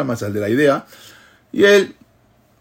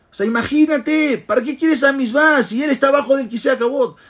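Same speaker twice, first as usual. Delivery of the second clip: rising fervent sermon; 11 semitones higher, 3 dB louder than usual. Retold or imitated. Rabbi's moral builds to climax.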